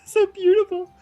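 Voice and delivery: mock swoon. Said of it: "She's like".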